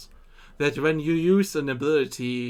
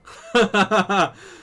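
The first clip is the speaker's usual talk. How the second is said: amused laugh; 7 semitones higher, 6 dB louder than usual. Real, not acted. Laughs at odd glitch.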